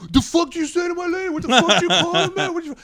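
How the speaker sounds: very high, loud mock tough-guy yell; aggressive drunk boyfriend. Boyfriend squares up to the comic, mock yelling. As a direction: bark like a drunk defending his lady. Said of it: "jumps out and goes".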